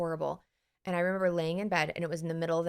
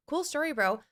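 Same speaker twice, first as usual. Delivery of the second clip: breezy dismissal; 8 semitones higher than usual. Retold or imitated. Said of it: "I loved".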